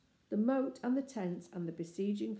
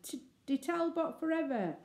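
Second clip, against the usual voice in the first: battle cry; 9 semitones higher than usual. A narrator's reading. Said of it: "the shouts of".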